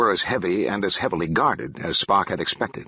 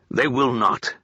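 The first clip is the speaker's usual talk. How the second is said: firm; 3 semitones higher, slower than usual. A narrator's reading. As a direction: plant each word, no room for argument. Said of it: "Ruinek said firmly".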